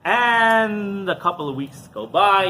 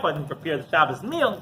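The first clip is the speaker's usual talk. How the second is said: ordinary talk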